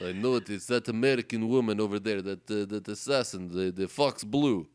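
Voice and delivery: put-on lightly held Russian accent. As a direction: keep the Russian accent going. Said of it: "can bring in the Russian voice again".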